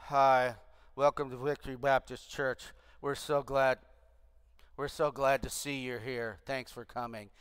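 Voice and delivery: low, flat, joyless welcome; gloomy church greeter. Pretend. Mocking a glum church greeter, low and joyless. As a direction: greet them like you'd rather be anywhere else. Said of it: "you don't want to be the greeter at the church and go".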